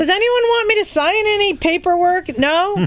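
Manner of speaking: pretend-eager, fishing for a contract; mock eager offer. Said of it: "I'm like".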